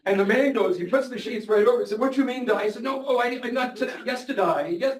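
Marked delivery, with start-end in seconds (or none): 2.0-2.7 alarmed patient. Retold or imitated. Scared patient hears "die. 2.8-5.0 flustered Australian doctor, pitched much higher; imitated hematologist scrambling to correct himself. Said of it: "he got all flustered".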